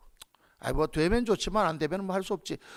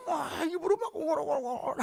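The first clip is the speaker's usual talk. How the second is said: mock tearful mumbling; 8 semitones higher, 4 dB quieter than usual. Imitating Hannah mumbling in prayer.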